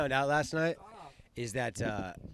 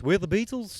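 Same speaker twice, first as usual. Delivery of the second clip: light mock Scouse voice, a bit higher; Liverpool accent; 6 semitones higher than usual. Showing off his Liverpool accent to friends, lightly mock Scouse. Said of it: "the Liverpool one's pretty nice".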